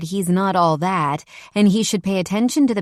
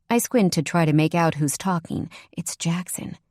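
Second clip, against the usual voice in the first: ordinary delivery, nothing marked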